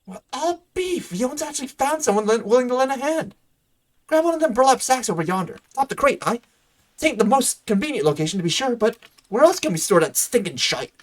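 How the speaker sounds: high-pitched, hammed-up country character voice; Gillian, rustic helper. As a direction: put on a high, folksy farmhand voice. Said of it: "Hi, Gillian".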